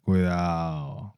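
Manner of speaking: slow spooky warning; slow drawn-out warning. Pretend.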